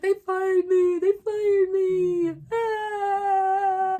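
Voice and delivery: high, loud mock boohooing; hammed-up crying. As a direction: wail it out like an exaggerated sobbing fit. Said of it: "I'm just crying and boohooing".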